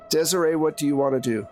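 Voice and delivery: usual speaking voice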